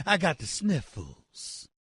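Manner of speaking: slow, soft, pitiful sick whine; milk the sniffles for sympathy; mock sniffles. Host mocks pitcher's sniffles excuse, slow pitiful whine.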